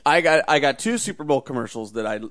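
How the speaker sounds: ordinary delivery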